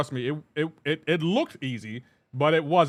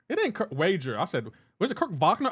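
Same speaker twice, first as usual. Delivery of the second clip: ordinary talk, nothing marked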